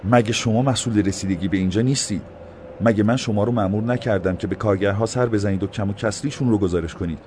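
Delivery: a touch of stern mayor; stern rebuke. A narrator's reading. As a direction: scold the officials. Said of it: "برق غضب چشمانش".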